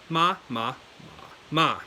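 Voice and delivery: four contrasting pitches on "ma"; four tones in order. Shown as a demonstration. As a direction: go through the tones on "ma". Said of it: "as you go through it".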